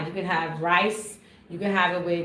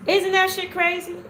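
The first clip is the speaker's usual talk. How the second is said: louder, much higher exclamation; excited exclaim; 10 semitones higher, 4 dB louder than usual. Real, not acted. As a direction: belt out the amazement. Repeats with emphasis.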